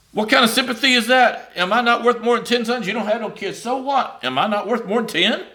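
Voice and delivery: high-pitched scoffing at the husband's words; mocking disbelief. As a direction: scoff at his "sympathy".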